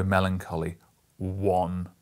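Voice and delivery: usual speaking voice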